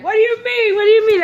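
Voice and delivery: very high, loud mock disbelief; hammed-up indignant imitation; incredulous protest. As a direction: squawk it like someone turned down unexpectedly. Acts out his indignant protest.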